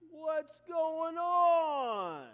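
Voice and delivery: slow, high, drawn-out call; long drawn shout. Acted. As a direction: stretch it into a long loud call. Older brother calls out.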